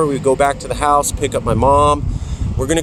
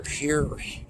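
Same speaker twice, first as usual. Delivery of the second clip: usual speaking voice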